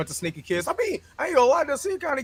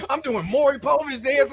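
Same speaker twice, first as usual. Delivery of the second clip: ordinary delivery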